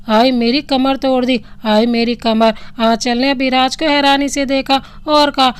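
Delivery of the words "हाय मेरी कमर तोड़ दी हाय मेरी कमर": ordinary delivery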